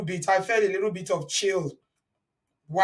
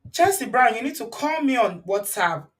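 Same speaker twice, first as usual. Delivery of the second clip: usual speaking voice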